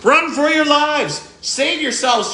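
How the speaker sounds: high, slow panicked yell; hammed-up alarm as the dragon arrives; panicked cry. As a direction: cry out in mock terror. Dragon appears, goblin panics.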